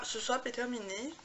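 normal talking voice

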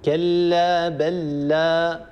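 بل لا said very slowly as one doubled lam. Shown as a demonstration. Teacher repeating بل لا to show the doubled lam. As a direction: draw out كلا بل لا with the lams merged. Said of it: "يصير نطق بلام مشددة".

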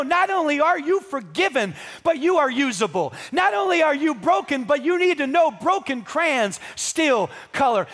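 loud, high, relentless pulpit exhortation; fervent, shouted encouragement to a congregation; impassioned preaching. Real, not acted. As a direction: preach it at full force, driving each phrase home.

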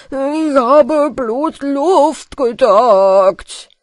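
loud, very slow mumbler's wail; thick-tongued shouted excuse; shouted protest. A narrator's reading. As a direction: yell it long and drawn out. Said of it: "schrie Peter wieder".

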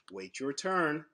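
plain reading voice

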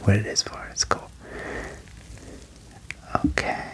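usual speaking voice

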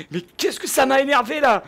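ordinary delivery

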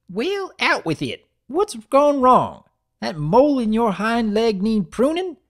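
put-on old granny bossing him to talk; hammed-up cranky grandmother; pushy granny. A narrator's reading. Granny demands.

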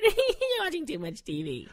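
high, drawn-out words through a laugh; laughing tease. Acted. Wife teases husband about watching TV, laughing. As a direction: tease him slowly while laughing.

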